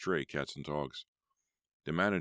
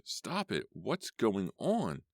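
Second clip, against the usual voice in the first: high scream stretching into a slow cry; 7 semitones higher, much slower than usual. A narrator's reading. Worker overwhelmed by shrieking animals, screaming. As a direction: cry out high, drawing out the question. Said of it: "I screamed".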